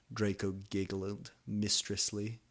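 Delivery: ordinary delivery, nothing marked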